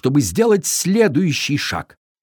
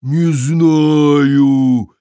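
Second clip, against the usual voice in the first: upset giant; 6 dB louder than usual. A narrator's reading. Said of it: "ещё больше огорчился великан".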